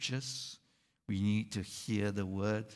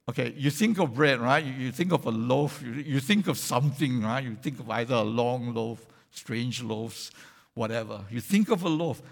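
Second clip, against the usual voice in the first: insistent preaching; 6 dB louder than usual. Acted. Preacher urging congregation to picture bread, loud and quick. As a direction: press the point, louder and fast.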